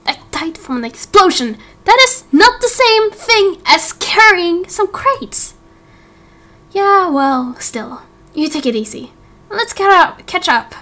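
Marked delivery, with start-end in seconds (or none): 1.9-5.6 higher, slow indignant voice; indignant protest. Acted. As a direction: spell it out, offended. Angel objecting to being coddled.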